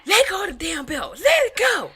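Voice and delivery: loud, high scolding mother yelling over the belt; hammed-up furious mother; mock angry mom. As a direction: yell at the kid like an angry mom.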